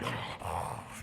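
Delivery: voiceless mock sobbing huff; little kid's huffing breath. Imitating himself little, crying before his uncle, shuddery huff. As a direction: huff out air like an upset child. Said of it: "you know how you are when you're little".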